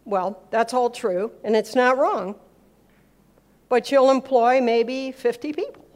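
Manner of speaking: ordinary talk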